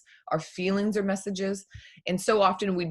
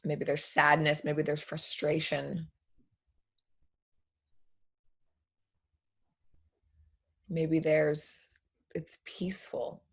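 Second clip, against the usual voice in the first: soft, unhurried meditation voice with long pauses; guided meditation; 3 dB quieter than usual. Acted. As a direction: guide them gently through breath and body. Leads a breathing meditation.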